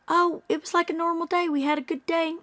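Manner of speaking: high, light, chirpy; mock breezy mother. Vlogger mimicking the mother's cheerful account, breezy. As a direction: mimic her sugary denial. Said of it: "everything she says".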